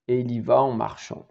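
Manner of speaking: ordinary talk, nothing marked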